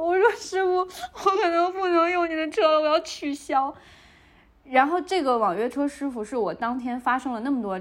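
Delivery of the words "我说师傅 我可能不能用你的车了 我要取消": high-pitched mock tearful plea; imitated crying. Tenant cancelling her ride with the driver, imitated crying. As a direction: replay a distraught wail in a much higher voice. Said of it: "我就哭着跟他说".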